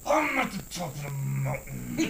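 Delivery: mock wrestler roar, held back; retold wrestler screaming. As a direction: imitate old wrestling bravado. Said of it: "we're screaming".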